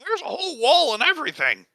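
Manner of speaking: high, huffy protest; protest, wounded pride; offended cultist. Acted. Cultist defending secret base.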